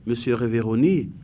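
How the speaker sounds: ordinary delivery, nothing marked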